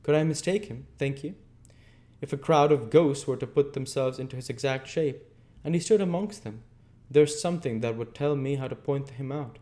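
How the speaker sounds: high, loud, hateful villain voice; man full of hatred. A narrator's reading. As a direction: spit out each threat with loathing. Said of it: "with such dreadful hatred".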